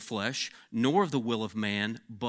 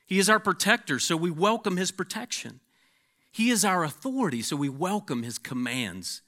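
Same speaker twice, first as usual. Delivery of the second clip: preacher chanting a list of God's titles; preaching; 2 semitones higher, 4 dB louder than usual. Acted. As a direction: build each line like a pulpit refrain. Reading Piper's litany with force.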